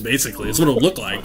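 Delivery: usual speaking voice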